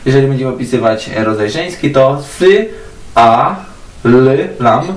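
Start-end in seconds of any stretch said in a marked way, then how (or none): none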